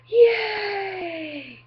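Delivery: high, loud burst of real joy; excited cheer. Real, not acted. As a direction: shout it out, thrilled to be done. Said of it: "I'm really friggin' happy".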